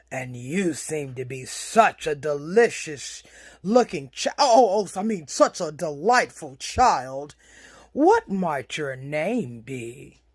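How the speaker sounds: low, smooth wolf voice, covering a slip; sly wolf. Acted. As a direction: drop low, hungry but courteous wolf.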